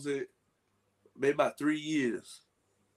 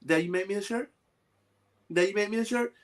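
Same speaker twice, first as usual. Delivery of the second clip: daughter asking; 5 semitones higher, faster than usual. Retold or imitated. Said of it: "she'll come in".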